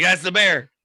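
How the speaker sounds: loud, high joke-teller's exclamation; acted sudden discovery; excited recognition. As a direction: blurt it as the hunter spotting his target. Hunter spots the same bear.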